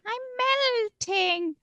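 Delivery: high witch shriek. Shown as a demonstration. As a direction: screech it like the Wicked Witch. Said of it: "like from Wizard of Oz".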